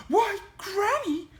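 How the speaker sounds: put-on high little-girl voice; high, wondering address to granny; slow, surprised girl. A narrator's reading. Little Red surprised in Granny's bedroom, high wondering voice. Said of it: "she said slowly".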